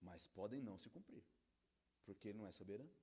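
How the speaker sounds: low, soft drop after preaching; subdued pulpit aside; hushed counterpoint. Real, not acted. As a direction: bring it right down, low and quiet. Sudden drop after shouted promise.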